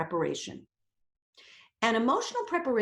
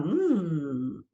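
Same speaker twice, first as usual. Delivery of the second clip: suggestive; 5 semitones lower, much slower than usual. Retold or imitated. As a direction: muse with a sly idea. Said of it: "your mind starts thinking about".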